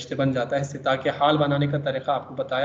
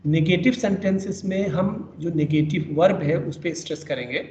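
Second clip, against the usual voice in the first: ordinary talk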